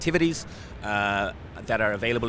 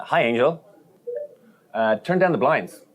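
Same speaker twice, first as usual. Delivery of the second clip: clear, raised voice for the assistant; talking to voice assistant; 3 semitones higher, 5 dB louder than usual. Shown as a demonstration. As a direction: speak up to a smart assistant. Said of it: "So we could begin by saying".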